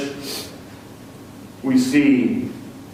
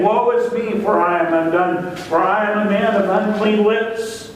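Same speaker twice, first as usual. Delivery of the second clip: prophet's cry of woe; 6 semitones higher than usual. Retold or imitated. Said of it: "he cries out".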